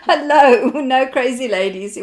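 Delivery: loud, high hello through laughter; exuberant greeting. Real, not acted. Host answers a viewer's crazy-lady joke, exuberant loud greeting. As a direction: burst out loudly in welcome.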